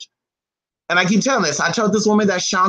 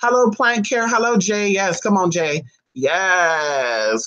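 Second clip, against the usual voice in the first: normal talking voice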